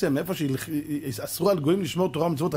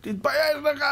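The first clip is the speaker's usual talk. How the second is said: higher rebuke; scolding; 10 semitones higher than usual. Retold or imitated. Rabbi shames the heckler, higher scold. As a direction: shame him sharply.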